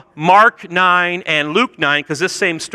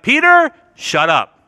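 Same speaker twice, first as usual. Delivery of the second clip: raised, slow rebuke; mock divine telling-off, slow and emphatic; God cutting Peter off; 6 semitones higher than usual. God interrupting Peter mid-sentence, mock stern rebuke. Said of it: "God's like".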